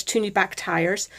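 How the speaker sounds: usual speaking voice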